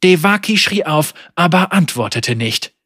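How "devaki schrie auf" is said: usual speaking voice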